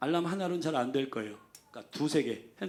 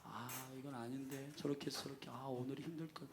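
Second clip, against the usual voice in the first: much softer, drowsy mumble; sleepy groaning reluctance; 2 semitones lower, 16 dB quieter than usual. Pretend. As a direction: sound like you can't get up. Mocking calculating hesitation. (이불 속에서 일어나기 싫은 졸린 중얼거림)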